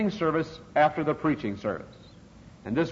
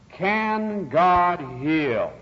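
very slow, raised, drawn-out question; imitated revivalist appeal, higher and deliberate; faith healer rousing crowd; 4 semitones higher, much slower than usual. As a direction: stretch each word out to the audience.

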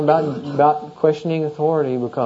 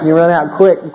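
ordinary talk, nothing marked

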